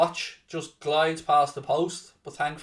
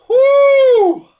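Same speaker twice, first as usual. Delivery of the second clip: long, very high, loud "woo"; real joyful release after a great goal; celebratory whoop; 12 dB louder than usual. Real, not acted. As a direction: let out a piercing whoop.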